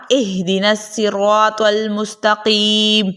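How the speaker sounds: اہدنا الصراط المستقیم recited slowly with a short hamza "ah" at the start; slow measured tajweed reading of the verse; teacher repeating the verse as read before; Quranic recitation. Shown as a demonstration. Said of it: "جیسے کہ ہم نے ابھی پڑھا".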